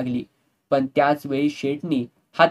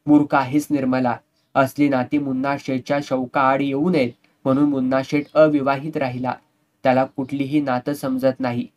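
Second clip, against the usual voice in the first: ordinary delivery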